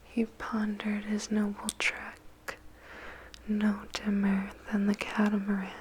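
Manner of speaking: ordinary delivery, nothing marked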